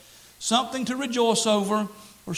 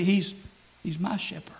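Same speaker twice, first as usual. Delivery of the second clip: ordinary delivery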